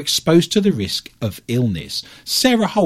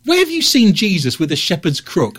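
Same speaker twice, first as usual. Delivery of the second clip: incredulous; 9 semitones higher than usual. Real, not acted. Incredulous challenge.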